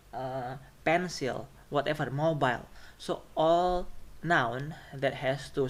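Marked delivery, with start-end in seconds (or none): none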